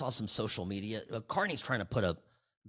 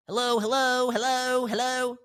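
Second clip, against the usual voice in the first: high, loud sing-song hello into the phone; a squeaky, pleading voice calling out; mock plaintive caller; 13 semitones higher, 9 dB louder than usual.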